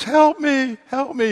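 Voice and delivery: high, pleading little voice; a small creature calling for help; tiny frog voice. Acted. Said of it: "he hears this little voice".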